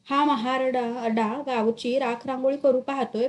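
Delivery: plain reading voice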